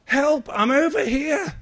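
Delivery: high, loud shouts of help; mock cry for help. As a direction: yell for rescue like a drowning swimmer. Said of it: "And I'd wave my arms".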